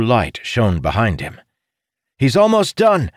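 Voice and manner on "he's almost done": high urgent shout. A narrator's reading. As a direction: yell it across the battle. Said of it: "Ilden shouted".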